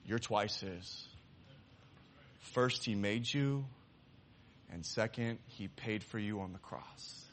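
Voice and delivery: quiet, slow, earnest preaching; soft sincere appeal. Acted. Preacher telling the congregation they belong to God, quiet and earnest. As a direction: let it land gently. Preacher applying the lesson softly.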